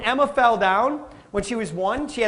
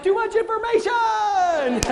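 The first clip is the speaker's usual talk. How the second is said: mock shock; 11 semitones higher, slower than usual. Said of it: "I'm like".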